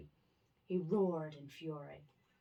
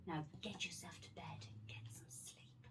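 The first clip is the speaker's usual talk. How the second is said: soft bedtime whisper; 9 dB quieter than usual. Real, not acted. Sending child to bed.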